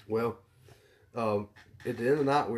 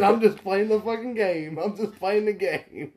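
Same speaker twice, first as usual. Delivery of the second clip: amused confession; 10 semitones higher, 6 dB louder, slower than usual. Retold or imitated. Imitating Trevor's amused confession.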